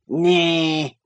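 nasal snarl. A narrator's reading. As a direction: snarl it out through the nose like a surly old man. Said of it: "he snarled, or rather screamed through his nose".

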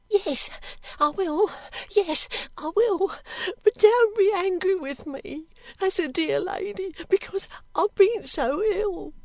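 high, tearful maid's voice; pleading servant girl. Acted. Girl begging the lady not to be angry, tearful. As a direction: beg forgiveness in an anxious whimper.

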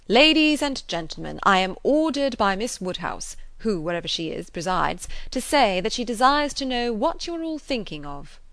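public announcement. Acted. Frank addressing the picnic party, mock-official announcement. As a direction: proclaim it to the assembled company.

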